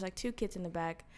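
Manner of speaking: ordinary delivery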